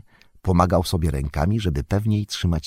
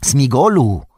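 higher, louder call by name; slightly louder call; 7 semitones higher, 6 dB louder than usual. A narrator's reading. Frodo calling Smigol a second time, louder call. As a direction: raise the voice to get attention. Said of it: "trochę głośniej powtórzył Frodo".

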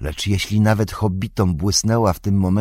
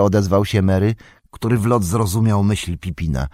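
usual speaking voice

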